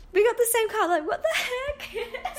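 high-pitched laughing disbelief. Real, not acted. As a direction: laugh through the words in amazement. Amazed by matching cards.

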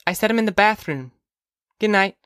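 put-on high child's voice; young girl. Daughter to mother about prayers, high girlish voice. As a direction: answer mother as a little girl.

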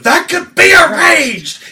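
high, loud, slowed-down roar; imitating Tim Curry's cardinal shout; villain shout. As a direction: belt out the threat. Retelling same Tim Curry line.

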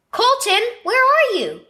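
high, loud call through the house. Acted. Big brother calling for little brother, high and loud. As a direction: call out to find him. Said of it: "Ian called".

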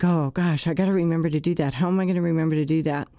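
fast, fretful inner voice, only lightly acted; rushed anxious self-talk. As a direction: rattle off the worry like a racing mind. Anxious bedtime thought voiced.

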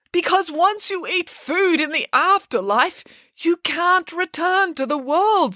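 high, slow, emphatic warning; dire insistence. Acted. Demeter explains the dire rule.

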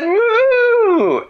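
very high, loud, long moo; emphatic cow. Acted. Cow replying to Dan's attempt, high emphatic moo. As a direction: blast out a high-pitched moo. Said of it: "And the cow said".

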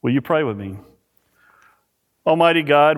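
ordinary talk, nothing marked

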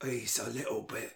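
soft, breathy Cockney; Michael Caine. Shown as a demonstration. Host correcting the impression for co-host, breathy Michael Caine. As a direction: drop low and let the breath through. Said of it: "I think he's a little too high".

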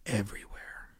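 whispered aside. Real, not acted. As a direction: drop to a whisper on the last word. Popcorn left everywhere, disgusted aside.

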